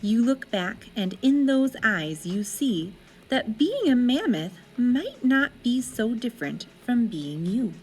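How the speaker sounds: plain reading voice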